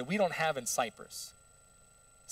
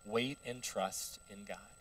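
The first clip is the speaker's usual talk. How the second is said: slow, soft, low reassurance; calm counsel; 5 semitones lower, 8 dB quieter than usual. Acted. Friend Elihu urging Job to trust, calm and slow. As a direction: counsel gently, giving each word time.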